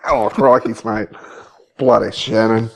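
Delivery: hammed-up Aussie accent, jokey; mock Australian accent. Teasing his co-host in a mock Australian accent. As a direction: play up a bit of Aussie slang in the accent. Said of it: "my Australian accent".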